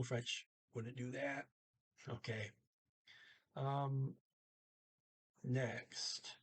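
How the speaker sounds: ordinary talk, nothing marked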